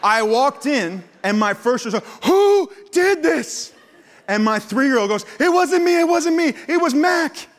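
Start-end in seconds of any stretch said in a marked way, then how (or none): 2.2-3.7 high, slow shouted demand; furious yell. Retold or imitated. Dad yelling at his boys over the Sharpie couch, outraged. 5.4-7.5 put-on high, loud child's denial; frantic little kid. Three-year-old blaming his brother Mac, high panicked kid voice.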